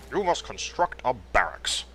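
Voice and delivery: quoting a game's instruction in a put-on voice; Warcraft game voice. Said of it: "they told you to do in one of the original".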